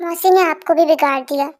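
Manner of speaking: ordinary talk, nothing marked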